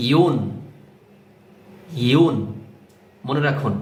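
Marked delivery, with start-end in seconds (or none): 0.1-2.3 ইউন said alone, y gliding into rounded u; careful, separated ইউন; demonstrated pronunciation. Shown as a demonstration. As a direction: model ইউন as one isolated syllable. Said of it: "এরকম".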